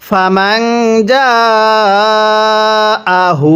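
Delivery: জাআহু stretched four counts; four-count madd; long madd. Shown as a demonstration. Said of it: "চার আলিফ টান দিব".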